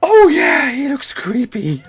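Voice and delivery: very high, loud squeal of agreement; mock-excited outburst. Real, not acted. Player reacts to a creepy man, high squealing outburst.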